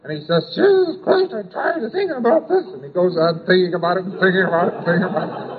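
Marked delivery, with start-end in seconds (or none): 0.5-2.6 much higher, fed-up cry of frustration; exasperated outburst. Pretend. Man fed up with his endless brooding, exasperated high outburst. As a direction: throw up your hands, sick of your own thoughts. Obsessed man voiced in an example.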